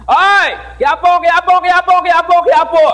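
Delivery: high, loud taunting chant of Quiapo; mocking shout. Classmates teasing him at the classroom door, loud mocking chant. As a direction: yell it like classmates teasing in a sing-song. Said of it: "sumisigaw sila".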